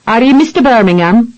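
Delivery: a light Irish brogue, politely asking; lightly voiced Irish captain; Irish accent, lightly done. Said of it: "with an Irish-infected brogue".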